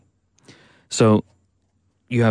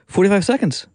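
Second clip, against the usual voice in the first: high, incredulous exclamation; mock astonishment; 11 semitones higher than usual. Real, not acted. Host teasing the guest for overrunning, in mock disbelief. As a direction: pitch it way up in disbelief.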